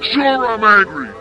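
normal talking voice

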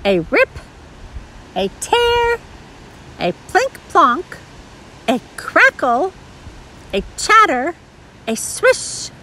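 each noise word lifted and set apart; picture-book sound list. A narrator's reading. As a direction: punch out each sound word with playful suspense.

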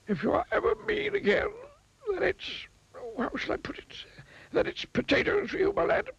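high wizard, stern warning; a high wizard wagging his finger. A narrator's reading. Wizard warning the miser sternly, high voiced. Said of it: "he added sternly".

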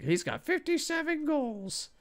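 high, slow put-on voice for the stat screen; a silly high voice mocking the screen; mock game voice. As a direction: read the number like a cartoon announcer. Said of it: "he's like".

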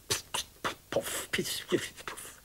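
soft unvoiced hissing puffs; fizzling firecracker. A narrator's reading. Narrator imitates a dud firecracker hissing softly to itself. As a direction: breathe out little fizzes and puffs. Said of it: "pyste tyst för sig själv".

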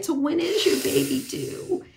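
light high-pitched voice of a stranger; mild imitation of a curious stranger; stranger's question. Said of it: "one of them saying".

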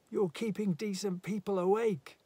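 high, loud scolding; indignant complaint. A narrator's reading. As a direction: shout indignantly across the way. Silhouette scolds the rowdy party.

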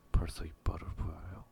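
ordinary delivery

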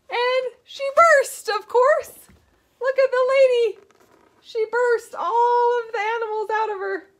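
animated puppet show voice; playful excitement. Pretend. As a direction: make the ending a big silly surprise. Puppet old lady story finale.

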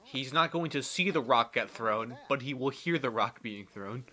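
ordinary delivery

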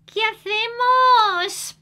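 put-on high, loud little boy's question; a scared boy asking for a plan; child asking. Manuelito asks grandma what to do, high and loud. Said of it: "Y Manuelito pregunta".

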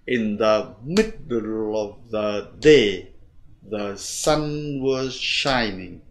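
slow beats on "middle", "day", "sun", "shining"; measured stress demo, "middle" and "day" strong; marked stresses. Shown as a demonstration. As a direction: punch "day" and draw out "sun". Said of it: "Cho nên chúng ta có trọng âm là".

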